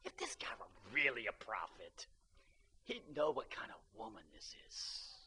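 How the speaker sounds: low, breathy whisper under his breath; whispered aside. Retold or imitated. Simon whispering his doubts to himself, hushed. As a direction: hush it like a private thought. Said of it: "And he goes".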